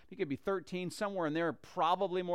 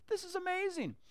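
much higher, awed and delighted; a kid overwhelmed with joy, pitched up high; thrilled young boy; 13 semitones higher than usual. Retold or imitated. David before the watching village, pitched high in awe. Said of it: "he's gotta be going like".

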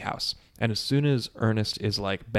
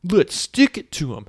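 loud, high mock battle cry; rally the gang like a cartoon kid hero; put-on heroic kid bravado; gung-ho kids; 4 semitones higher, 4 dB louder than usual. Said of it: "the kids are like".

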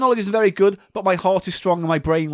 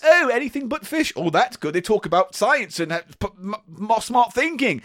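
mocking snooty judge; 6 semitones higher than usual. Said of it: "Because people go".

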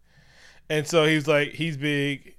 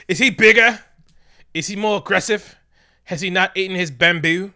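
high, loud hammed-up British accent; shrieky-high comic British questioning; mock British accent; 8 semitones higher, 13 dB louder than usual. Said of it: "she's British".

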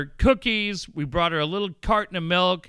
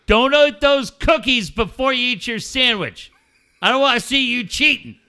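loud, higher playful scolding call; yelling across a lot; 8 semitones higher, 9 dB louder than usual. Retold or imitated. Teasing Anna from across the parking lot, loud and high. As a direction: holler a joking warning from far off. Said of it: "I yelled at her across the parking lot".

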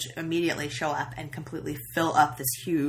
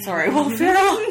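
laughing; 11 semitones higher, 6 dB louder than usual. Real, not acted. Laughing self-correction.

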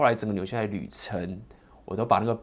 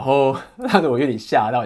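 laughing surprise; 5 semitones higher, 11 dB louder than usual. Real, not acted.

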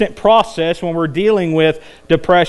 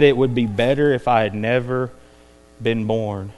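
low, subdued, dropping away softly; understated, lowered voice of someone despairing; acted despair; 8 semitones lower, 5 dB quieter than usual. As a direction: sink quietly into hopelessness. Voicing someone wishing never born.

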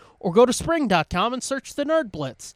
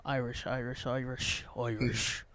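muttered Irish accent warm-up, low and soft; quietly tuning up an Irish lilt; 8 semitones lower, 13 dB quieter than usual. Shown as a demonstration. Host warming up an Irish accent for Qui-Gon, muttered softly. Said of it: "That's not bad, I don't think".

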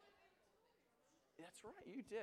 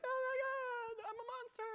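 mock crying girlfriend; 5 dB louder than usual. Girlfriend after hitting him, hammed-up horrified wail. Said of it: "She's like".

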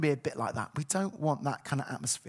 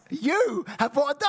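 higher, louder rising question; kids' disbelief; 9 semitones higher, 5 dB louder than usual. Retold or imitated. Children doubting dad really bought a dog, surprised. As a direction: play stunned children asking.

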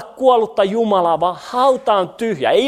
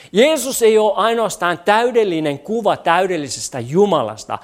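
normal talking voice

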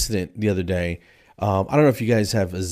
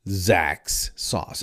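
ordinary delivery

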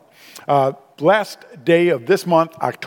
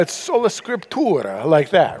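mock Italian accent. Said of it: "heavy Italian accent".

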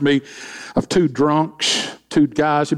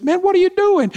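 high incredulous call; observer calling to the jumping man, much higher. Retold or imitated. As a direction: shout it across at the man, amazed. Observer calls out to jumper.

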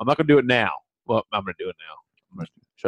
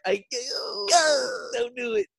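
mock pleading. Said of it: "I, uh, like".

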